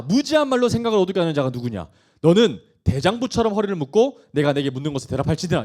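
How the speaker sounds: loud, commanding voice of God; forceful scripture reading. Acted. God challenging Job out of the storm, forceful. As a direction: read it out as a stern challenge.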